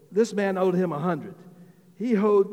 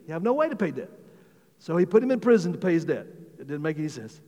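usual speaking voice

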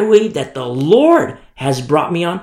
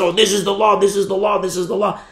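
mimicked law-followers; 4 semitones higher, faster than usual. Preacher mimicking people who look to Moses, parroting. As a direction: parrot it in a raised voice.

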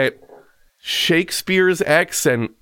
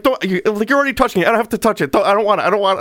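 exasperated refusal; 6 semitones higher, much faster than usual. Retold or imitated. Retold refusal of the object.

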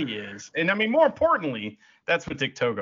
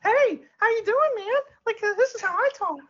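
mock high voice. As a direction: play back the squeaky voice you were given. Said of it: "they go".

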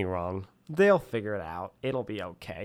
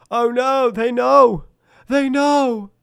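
mock panic; 13 semitones higher, 9 dB louder, slower than usual. Imagined panic on critique.

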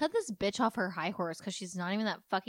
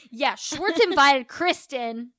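loud, slow, higher mock accusation; mock blame-shifting; 5 semitones higher, 11 dB louder, much slower than usual. Podcaster mocking a cast member ratting out Schwartz, loud and exaggerated. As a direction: pin it on him, loud and drawn out.

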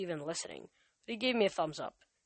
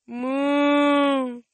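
long high protesting moo; 8 semitones higher, 10 dB louder than usual. A narrator's reading. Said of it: "Bessie protested".

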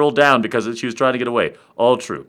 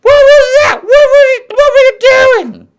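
shocked woman; 10 dB louder than usual. Acted. Woman to her lover after sex, outraged high shriek. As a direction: shriek it in mock outrage at the top of your voice. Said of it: "and she was like".

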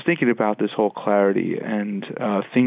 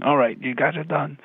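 announcing dad; 5 semitones higher than usual. Retold or imitated. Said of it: "I'm notorious for saying".

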